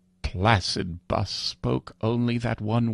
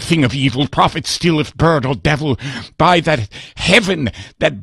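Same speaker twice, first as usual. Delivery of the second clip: higher, louder, yearning cry; anguished plea; 8 semitones higher, 5 dB louder than usual. Acted. Narrator begs the raven whether he will meet Lenore, higher and pleading. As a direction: plead for reunion with a breaking heart.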